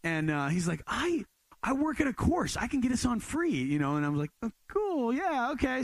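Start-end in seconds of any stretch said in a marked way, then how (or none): 0.9-3.5 voicing classmate. Other guy's offer, voiced. 4.4-5.8 eager reply. Retold or imitated.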